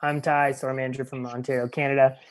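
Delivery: normal talking voice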